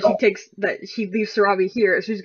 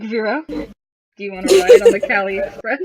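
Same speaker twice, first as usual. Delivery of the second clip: teasing; 8 dB louder than usual. Acted. Teasing flirt line.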